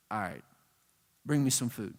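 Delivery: very low, quiet God voice; understated low-voiced divine reply; cool acceptance. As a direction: accept the challenge coolly, dropped low and soft. Acted God, unimpressed by Gideon's test.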